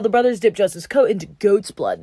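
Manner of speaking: ordinary delivery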